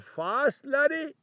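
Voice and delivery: imitated Scottish dad, rising in pitch; broad Scottish accent. Old dad questions his son about fasting, broad Scottish accent. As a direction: query it in a mock Scottish accent. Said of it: "He had a very broad Scottish accent".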